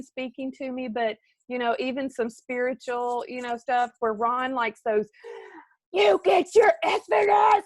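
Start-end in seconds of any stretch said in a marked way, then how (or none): 5.9-7.6 high, loud mock motivational-speaker shout; imitated yelling. Imitating the speaker husband listens to, shouting. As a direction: bellow it like a fired-up coach. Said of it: "That man is yelling".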